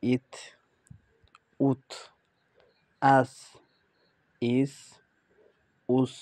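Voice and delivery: ইত উত আস ইস উস, প্রতিটি আলাদা করে স্পষ্ট; teacher models each syllable; Arabic reading drill. Shown as a demonstration. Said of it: "আমি পরের শব্দগুলি পড়ে দিচ্ছি". (শিক্ষকের মতো ধীরে ধীরে উচ্চারণ করে দেখানো)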